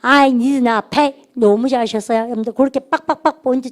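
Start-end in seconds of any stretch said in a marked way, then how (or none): 0.0-1.1 very slow, every word stretched; slow drill. Shown as a demonstration. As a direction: draw it out for the class to copy. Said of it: "시작".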